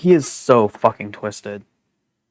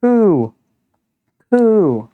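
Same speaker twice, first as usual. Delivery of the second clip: pigeon coo; 8 semitones higher than usual. Acted. Pigeon character cooing.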